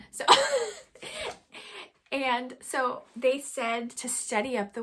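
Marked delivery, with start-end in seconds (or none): 0.3-1.9 sudden high squealing laugh; high burst of laughter. Real, not acted. Vlogger laughing at her own fandom, high squeal.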